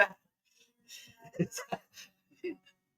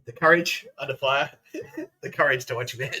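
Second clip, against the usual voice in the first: ordinary delivery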